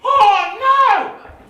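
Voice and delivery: high, loud dismay; horrified cry. Acted. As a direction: cry out in shock. Shocked exclamation.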